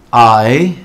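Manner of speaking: eye said low with a long 'ai' glide; long I sound. Shown as a demonstration. Teacher modelling the Y rule for learners, long I sound. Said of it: "sonará como un long I sound".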